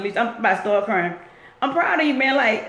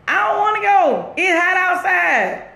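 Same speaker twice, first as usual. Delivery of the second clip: high, louder mock wail; protesting wail; 6 semitones higher, 3 dB louder than usual. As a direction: plead in a high, drawn-out whine. Acting out refusal of hell.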